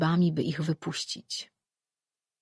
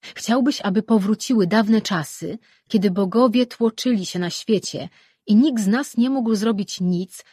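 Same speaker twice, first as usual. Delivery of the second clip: higher and louder, angry contempt; scornful tirade; 5 semitones higher, 8 dB louder than usual. A narrator's reading. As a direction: lash out in scornful anger. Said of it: "głosem aż ociekającym złością i pogardą".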